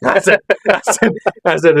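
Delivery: high, laughing stammer through the words; cracking up while trying to get it out. Real, not acted. Husband laughing at his wife's shock, stammering through giggles.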